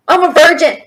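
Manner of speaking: embarrassed shout at the dragon; shouted confession. Acted. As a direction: yell it out in exasperation. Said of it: "shout my sexual history".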